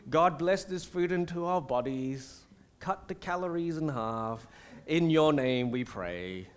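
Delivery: a churchgoer rattling off a throwaway grace; mock prayer. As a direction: parody a lazy rote prayer under the breath. Parodies a trivial mealtime prayer.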